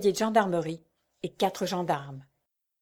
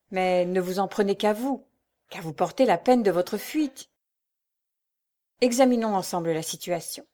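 ordinary delivery

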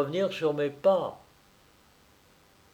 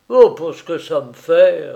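loud, scornful retort; brusque dismissal; 12 dB louder than usual. A narrator's reading. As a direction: toss it off loudly, not caring. Master dismisses the maid brusquely. (réplique brusque et forte)

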